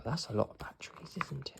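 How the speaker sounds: fast, soft whisper; whispered aside. Real, not acted. Reader's aside to listener.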